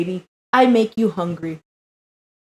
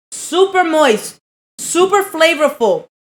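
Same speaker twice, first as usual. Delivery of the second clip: high, slow savoring praise; 6 semitones higher, slower than usual. Real, not acted. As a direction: savor each word as you praise. Savouring the first bite.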